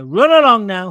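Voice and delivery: loud sing-song run along; mock dismissal. Real, not acted. Waving off the banned commenter, loud mock dismissal. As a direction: shoo him off, loud and mocking.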